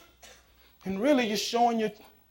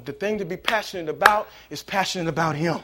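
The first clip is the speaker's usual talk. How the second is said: normal talking voice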